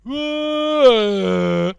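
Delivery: loud, long donkey bray; braying attempt at singing. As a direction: blare out an awful sung note. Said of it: "dia nyoba nyanyi".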